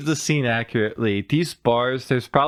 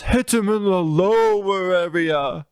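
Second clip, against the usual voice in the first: high, slow put-on parrot voice; quoting Polly; 7 semitones higher, slower than usual. Recounting the parrot's advice, high slow put-on voice. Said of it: "and he says".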